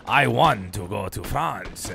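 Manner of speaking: hammed-up French with a jolly chuckle; badly faked French accent, drawn out; mock French accent. Streamer trying accents about France, exaggerated mock French. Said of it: "How many accents can I do?".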